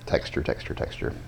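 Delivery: breathy whispered repetition. Acted. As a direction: whisper it, fading away. Whispered emphasis on texture.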